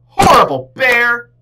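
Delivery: high furious shout of the name; girl shouting. A narrator's reading. Angry girl yelling at Bear. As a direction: yell it at the bear who broke the kite. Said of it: "the girl shouted".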